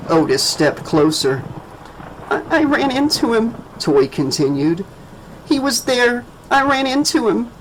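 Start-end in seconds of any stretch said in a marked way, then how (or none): 2.3-7.5 put-on high, shaken child voice; a scared child telling; frightened little girl. A narrator's reading.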